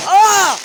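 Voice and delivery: short, high, loud yell; startled scream. Real, not acted. Vlogger jolted by firework, startled scream. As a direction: cry out at a sudden bang.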